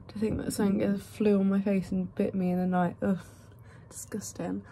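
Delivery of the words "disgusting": disgusted whisper. Real, not acted. Grossed out by a bite.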